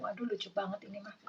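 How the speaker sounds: a quick, fast little whisper; whispered aside. Real, not acted. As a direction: whisper it to yourself, delighted. Aside reacting to the card. (bisikan cepat, gemas sendiri)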